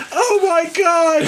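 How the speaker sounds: high, slow panicked "Oh my God"; shocked cry. Retold or imitated. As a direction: gasp it out in horror. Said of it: "I'm just like".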